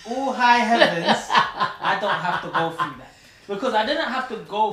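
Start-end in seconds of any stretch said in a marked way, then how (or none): 0.1-3.1 laughing relief. Retold or imitated. Retelling relief after a dream.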